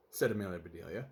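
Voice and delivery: usual speaking voice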